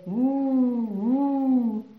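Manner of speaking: long, hooting wind imitation; howling winter wind. A narrator's reading. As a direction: howl like wind in a storm. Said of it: "Le vent d'hiver hurlait".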